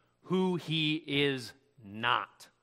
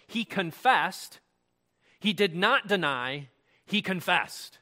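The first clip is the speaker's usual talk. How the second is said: "confessed" and "not deny" hit firmly; emphatic; 3 dB louder, slower than usual. Retold or imitated. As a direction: set each clause apart and stress "confessed". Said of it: "he's being emphatic here".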